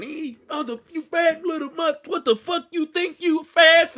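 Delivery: high, loud imitation of an enraged father; mock furious dad. Dad scolding daughter while whooping her, loud mock rage. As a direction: bellow it like a dad losing it. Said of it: "He going".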